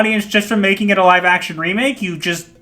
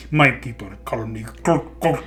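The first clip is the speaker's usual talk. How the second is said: low, softer put-on character voice; Namor; 8 semitones lower, 5 dB quieter than usual. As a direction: imitate a solemn film villain, pitched down. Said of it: "he's like".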